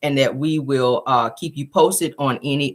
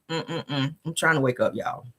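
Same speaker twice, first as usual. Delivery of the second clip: ordinary delivery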